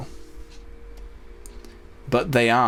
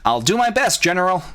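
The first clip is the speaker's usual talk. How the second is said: much higher game-hero impression; Star Fox; 7 semitones higher than usual. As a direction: do an eager pilot answering the General in a high voice. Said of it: "And my Star Fox impression".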